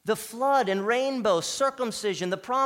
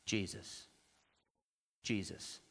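low, soft answer, set apart by pauses; hushed reverence; 11 semitones lower, 13 dB quieter than usual. Real, not acted. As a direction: drop right down and let the name land. Hushed answer to his own question.

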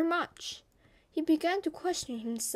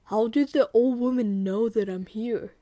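loud, mildly startled tiger voice; surprised tiger; 6 dB louder than usual. Acted. Tiger overhearing the old woman, mildly surprised. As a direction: voice a tiger taken aback, louder. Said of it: "The tiger was surprised".

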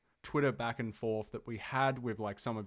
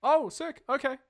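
high, loud burst of delight; pleased surprise; 13 semitones higher, 8 dB louder than usual. Real, not acted. Contestant learning the first guess was right, delighted. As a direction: celebrate a win out loud.

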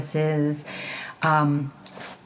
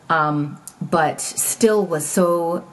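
ordinary talk

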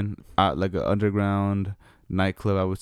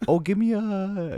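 mock high girly voice; 10 semitones higher, slower than usual. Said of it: "Oh, yeah".

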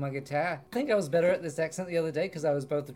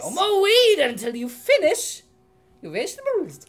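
higher scolding mother; prim mother voice; 12 semitones higher, 9 dB louder than usual. Acted.

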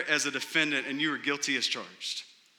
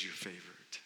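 very soft, tender pronouncement; hushed blessing; 9 semitones lower, 17 dB quieter than usual. Retold or imitated. Judge declaring the defendant favored, very soft. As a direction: breathe it out like a blessing. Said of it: "he says".

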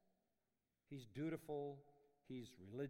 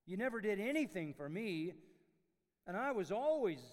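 loud higher aggrieved outburst; indignant complaint; 7 semitones higher, 12 dB louder than usual. Acted. Legalist before God's judgment, loud indignant complaint. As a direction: hurl it like a wronged servant.

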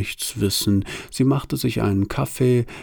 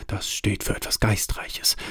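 softer, breathy aside under the breath; half-voiced aside; 7 dB quieter than usual. A narrator's reading. Desire murmuring to Boredom, a quiet half-voiced aside. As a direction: lean in and murmur it to your neighbour.

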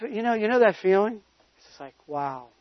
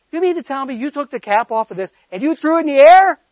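incredulous scolding; 6 semitones higher, 6 dB louder than usual. Retold or imitated. Mother scolding son.

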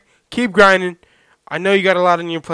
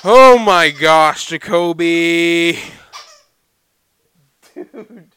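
loud, drawn-out slow groan of the name; exasperated at the dog; 8 dB louder, much slower than usual. Real, not acted. To his dog squeaking the toy again, loud slow exasperation. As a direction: stretch it out loud and fed up.

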